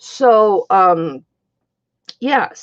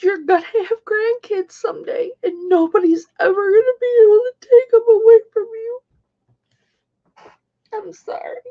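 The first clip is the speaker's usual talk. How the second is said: high tearful voice, sinking to a tiny apology; overcome, voice pitched high with crying; 13 semitones higher than usual. Real, not acted. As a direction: keep talking through real tears. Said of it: "I'm sorry I'm so sensitive".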